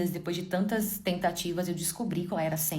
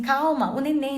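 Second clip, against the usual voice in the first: pleading husband imitation; 8 semitones higher, 6 dB louder, slower than usual. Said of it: "Aí ele falava assim".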